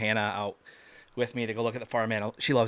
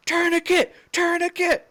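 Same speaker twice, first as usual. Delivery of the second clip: very high, loud urgent cry; re-enacted scream; 4 dB louder than usual. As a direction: scream for first-aid gear, as retold.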